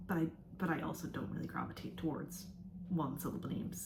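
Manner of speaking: normal talking voice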